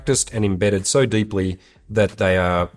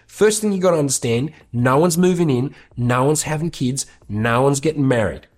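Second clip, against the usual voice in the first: imitation of a blunt man laying down his law, pitched higher; Sir Dominic; 6 semitones higher than usual. Friend telling new girlfriends his four rules, emphatic impression. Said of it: "he says them exactly like this".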